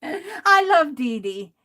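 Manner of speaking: ordinary delivery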